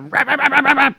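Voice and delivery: high-pitched imitated yapping; mock yapping. Host mocking guys with nothing to back it up, mock yapping. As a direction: yap like an all-talk tough guy. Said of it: "you'll get guys that fucking just".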